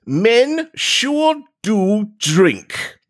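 very slow, solemn reading of the note; slow dramatic reading. Pretend. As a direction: read it slowly, like evidence. Rereads the note slowly.

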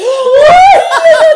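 very high, sustained scream; loud shriek. Real, not acted. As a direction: shriek at the top of your voice. Outburst over the game. (chillido agudo y fuerte)

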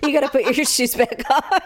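pleading through laughter; laughing dad. Retold or imitated. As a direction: play the dad giving in. Father voiced complaining.

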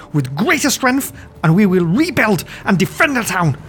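high noble voice forcing command; shaky authority. Acted. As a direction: declare orders while still trembling with fear. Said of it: "Harbin's voice regains a semblance of authority".

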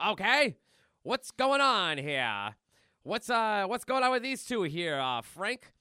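loud, hammed-up beat cop; cartoon cop demanding answers; New York cop. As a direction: take charge like a city policeman. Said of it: "the cops go".

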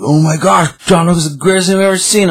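mocking a fan who raves about John Wick; mock gushing fan. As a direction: gush like an overexcited superfan. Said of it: "I'm not like".

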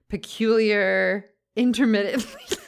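"peculiar" and "intermittently" said slow and high, savouring each syllable; "peculiar" drawn out, "intermittently" stressed with a laugh; big words emphasized. Shown as a demonstration. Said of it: "this person likes big words".

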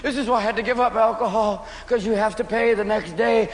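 ordinary delivery

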